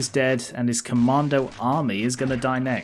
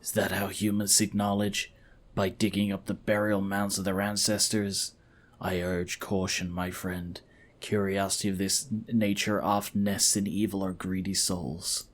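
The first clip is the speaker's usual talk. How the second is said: low, soft and solemn; grave, cautioning companion; 5 semitones lower, 7 dB quieter than usual. Acted. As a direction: quietly urge caution in a low voice.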